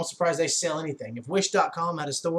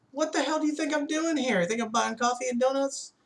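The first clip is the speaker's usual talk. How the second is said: higher, mock-baffled manager; scornful disbelief; 10 semitones higher than usual. Manager scoffs at the salesman selling a pool, pitched-up mock disbelief. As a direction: play the manager scoffing at the obvious.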